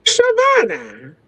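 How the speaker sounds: drawn-out high name call; reproachful call. Real, not acted. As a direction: call her name to stop her.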